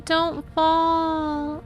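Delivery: ordinary talk, nothing marked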